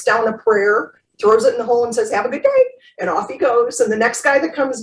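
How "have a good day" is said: high-pitched, cheery mock farewell; breezy send-off. Priest leaves man stuck in hole, mock-breezy farewell. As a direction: toss off a high, carefree goodbye.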